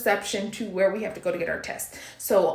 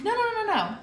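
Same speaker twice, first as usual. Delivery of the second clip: reassuring no; 11 semitones higher, faster than usual. Retold or imitated. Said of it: "And I'm like".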